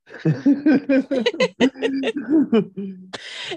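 loud high burst of laughter. Real, not acted. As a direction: let out a big high laugh. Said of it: "Yeah, it's what you do".

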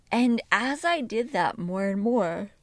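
talking through a yawn; slow words stretched by a yawn. Real, not acted. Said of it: "I am yawning again".

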